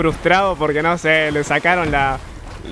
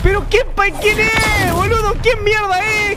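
furious yelling; 12 semitones higher, slower than usual. Real, not acted. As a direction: blow up at the game. Raging at the game.

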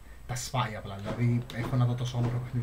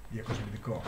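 ordinary talk

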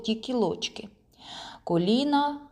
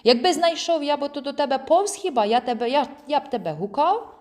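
high-pitched, huffy comeback; annoyed retort; 6 semitones higher, 3 dB louder than usual. A narrator's reading. Friend retorting to a silly question, annoyed. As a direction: snap back as if it's obvious. Cross retort after mocking echo.

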